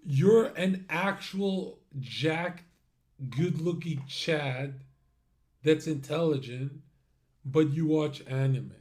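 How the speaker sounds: slow, loud, emphatic ranting read-out; mock chat rage. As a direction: read it out loud and drawn-out, hammering each phrase. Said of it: "listen to Zerka rage in chat".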